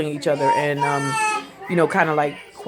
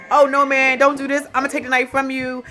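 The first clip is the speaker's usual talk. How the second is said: Charlie pleading; 6 semitones higher than usual. Retold or imitated. Charlie begging OJ to stop, raised pleading voice. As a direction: beg him to put the knife down.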